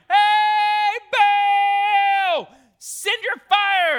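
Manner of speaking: drawn-out full-voiced high yell; calling out. Prophets calling on Baal in the morning, loud drawn-out shout. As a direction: holler it up to a faraway god. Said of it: "So they start calling in the morning".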